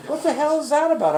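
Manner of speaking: high, loud comic exasperation; mock outrage. Trainer on keyboards sold without sustain pedals, loud mock outrage. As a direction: exclaim it way up high, baffled.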